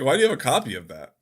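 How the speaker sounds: incredulous. Retold or imitated. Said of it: "it's like".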